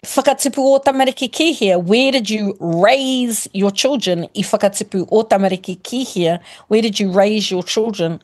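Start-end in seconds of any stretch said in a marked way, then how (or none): none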